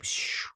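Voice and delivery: quick airy flying whoosh; whooshing sound. Retold or imitated. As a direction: blow a soft whoosh for takeoff. Imitates flying off.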